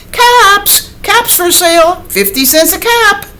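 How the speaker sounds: high, loud street-vendor call; peddler calling out. Acted. Peddler crying his caps to the town. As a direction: call out your wares down the street.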